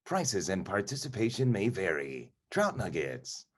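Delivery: soft, hushed mock announcer read; understated commercial tagline delivery; true announcer voice. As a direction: do a quiet, polished ad-announcer voice. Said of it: "like, a true announcer voice".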